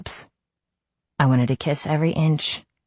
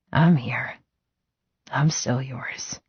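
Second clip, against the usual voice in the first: pained murmur; slower than usual. Acted. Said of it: "His voice haunted with pain".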